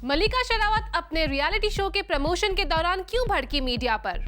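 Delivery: ordinary talk, nothing marked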